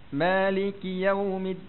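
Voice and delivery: slow chant with long held vowels; Quranic recitation. Shown as a demonstration. Teacher recites the fourth verse, Quranic chant. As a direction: recite on a sustained, even tone.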